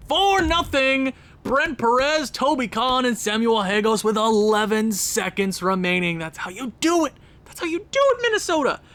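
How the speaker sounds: high-pitched goal call climbing to a squeal; excited celebration. Real, not acted. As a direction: cheer the team on, voice shooting way up at the end. Celebrating late goals in period.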